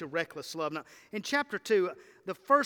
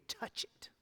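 whisper; 12 dB quieter than usual. Real, not acted. Hushed climax word.

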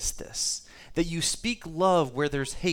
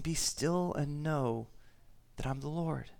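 very slow and much softer; slow hushed scripture; 3 semitones lower, 8 dB quieter than usual. Acted. Preacher quoting God to calm his flock, hushed and slow. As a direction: let each word settle, gentle and still.

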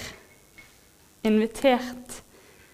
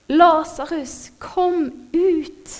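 mild reenacted shout; 6 semitones higher, slower than usual. A narrator's reading. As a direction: call him out in a high, held voice. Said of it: "så ropte han med høy røst".

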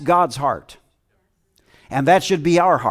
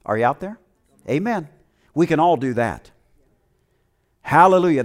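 ordinary delivery

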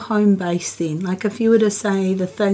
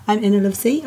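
ordinary delivery

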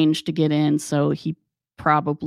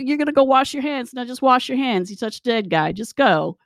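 exasperated instructing; 7 semitones higher than usual. Retold or imitated. Calming a hysterical coworker.

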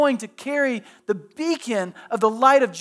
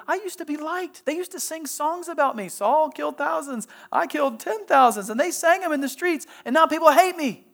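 higher, plaintive voice of a wounded king; voicing David's hurt; 6 semitones higher than usual. David lamenting to God that people now hate him, higher and plaintive. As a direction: lift the voice into David's self-pity.

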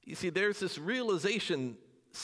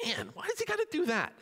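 much higher whiny complaint; mock exasperation; 14 semitones higher than usual. Disciple grumbling about Jesus showing off, whiny and high. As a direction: groan in mock annoyance.

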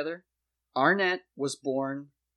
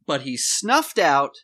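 loud, emphatic reveal; dramatic climax; 2 semitones higher, 7 dB louder than usual. Real, not acted. Host narrates the credits as proof, dramatic climax. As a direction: build loudly to the big clue.

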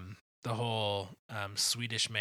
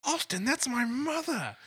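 much higher, louder, slow protest; Basil indignant; 7 dB louder than usual. Retold or imitated. Host quoting Basil defending his mother. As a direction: protest like an offended Englishman. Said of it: "Basil goes".

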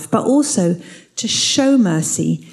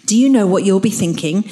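usual speaking voice

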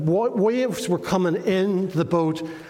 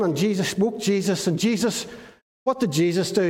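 ordinary talk

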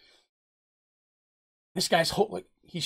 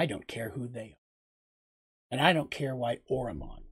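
quiet menace; 4 dB quieter than usual. Acted. Seraph tells the suspicious man he will protect Dina, quiet menace. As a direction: let the threat sit quietly and drag each word.

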